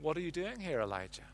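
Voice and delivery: softly, almost hushed, a gentle divine question; gentle quiet question. A narrator's reading. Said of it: "in the midst of solitude and silence".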